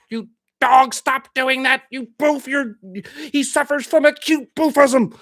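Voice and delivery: high, loud mock scolding of the dog; yelling at the dog. Owner yelling at his misbehaving dog, loud exasperated scolding. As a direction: scold the dog at top volume, half-joking. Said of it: "yelling at him in tongues".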